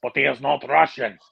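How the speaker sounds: put-on comic Russian accent. Repeating the audition joke, in a Russian accent. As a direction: say it as a mock Russian. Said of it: "tried to speak in a Russian accent".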